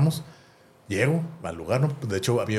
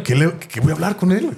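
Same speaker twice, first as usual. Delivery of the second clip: higher, louder nervous question; excited worry; 7 semitones higher, 4 dB louder than usual. Retold or imitated. Fan in the shower before meeting his idol, excited. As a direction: ask yourself in a thrilled panic. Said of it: "estaba así bien emocionado".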